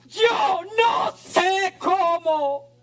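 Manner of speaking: crying out. Real, not acted. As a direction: cry it out from the soul. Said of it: "Perdónenme que esté gritando".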